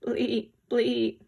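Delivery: bleating goat noise; mock goat bleat. Shown as a demonstration. Animal sound quiz for kids.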